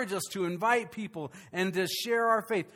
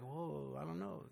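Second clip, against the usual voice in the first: very soft, lower, unsure; mock timid mumble; 4 semitones lower, 16 dB quieter than usual. Pastor imitates congregation's quiet response, mock timid. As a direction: mimic a shy churchgoer barely saying it. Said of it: "Some of you said that really quietly".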